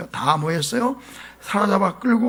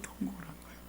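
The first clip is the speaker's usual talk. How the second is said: whisper; 4 semitones lower, 18 dB quieter, much faster than usual. Shown as a demonstration. Preacher echoing the people's wailing, in a whisper. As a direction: breathe it out in a hushed whisper.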